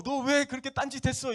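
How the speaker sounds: mock accusing. Shepherd blaming the sheep for straying, mock accusation. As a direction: imitate someone condemning a wanderer. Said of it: "이렇게 막 정죄하거나 판단하지".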